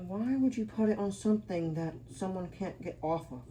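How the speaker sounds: usual speaking voice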